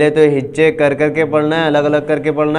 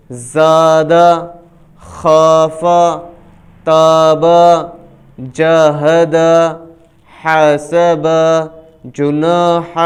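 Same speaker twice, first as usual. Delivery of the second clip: each word read out slowly with pauses; teacher reading words smoothly for students; fluent reading demo; slower than usual. Shown as a demonstration. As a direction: model the joined word clearly. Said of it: "اب رواں پڑھیں گے۔".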